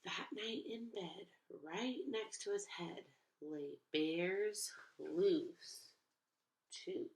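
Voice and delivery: put-on lively read-aloud to kids; expressive picture book narration; storybook reading. A narrator's reading. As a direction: read it like storytime for little ones.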